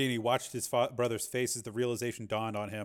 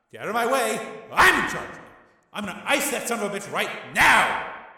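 loud, higher furious orders; furious shout; 10 semitones higher, 8 dB louder than usual. A narrator's reading. Tommy shouting his brother out of the way. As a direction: shove him aside with your voice.

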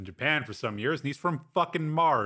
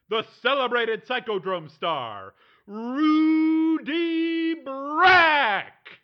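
high, loud, stretched announcer call; fighting game announcer; 13 semitones higher, 5 dB louder, much slower than usual. Acted. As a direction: hype up the fighter's name. Game announcer introduces star over PA.

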